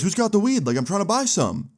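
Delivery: high-pitched annoyed sarcasm, imitating the man; sarcastic. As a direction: mimic pushy, sarcastic interest up high. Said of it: "still sounding, like, real sarcastic".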